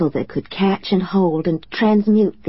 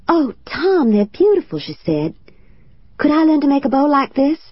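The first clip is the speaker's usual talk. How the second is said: put-on excited child; 9 semitones higher than usual. Excited child thanking for gift.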